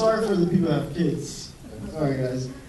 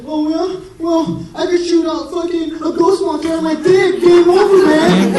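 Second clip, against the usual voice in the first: put-on much higher and louder child voice making up rules; bratty kid; 11 semitones higher, 7 dB louder than usual. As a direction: shout it like a little kid who just won by cheating. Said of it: "He's like".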